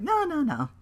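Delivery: hammed-up nervous manager; Paul Heyman impression. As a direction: hurry to smooth it over. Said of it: "He's like".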